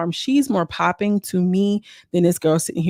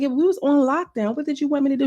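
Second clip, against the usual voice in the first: mock heated reply; 8 semitones higher than usual. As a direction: throw it back at her, voice up high. Quoted argument continues.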